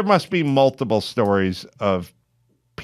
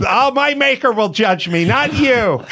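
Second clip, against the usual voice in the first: lofty, higher self-righteous retort; mock pious; 6 semitones higher than usual. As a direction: play the holy martyr.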